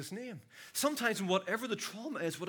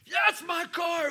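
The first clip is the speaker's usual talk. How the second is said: higher, raised-voice call; yelling while running; 9 semitones higher, 5 dB louder than usual. Retold or imitated. Chasing thieves, yelling at them.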